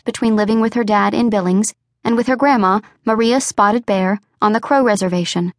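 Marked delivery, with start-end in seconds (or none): none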